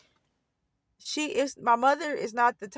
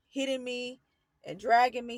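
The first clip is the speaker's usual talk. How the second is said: ordinary delivery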